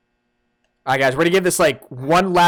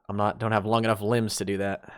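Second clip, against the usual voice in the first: ordinary delivery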